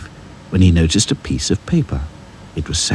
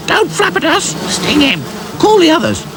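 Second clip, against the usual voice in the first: very high, shrill angry swarm; annoyed wasps. A narrator's reading. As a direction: squeal it like furious wasps. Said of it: "The wasps got annoyed".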